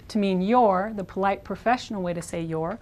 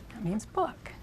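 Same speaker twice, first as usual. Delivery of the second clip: ordinary talk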